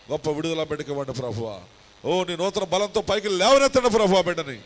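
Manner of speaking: from steady to loud, high pleading; building healing prayer. Acted. Pastor praying for the sick, rising to a loud cry. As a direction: swell into a fervent plea for healing.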